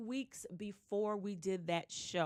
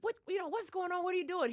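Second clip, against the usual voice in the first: retold puzzled demand, voice pitched much higher; 11 semitones higher, 3 dB louder than usual. Confronting a laughing colleague, high and puzzled. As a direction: ask it high, baffled and insistent. Asking what's funny.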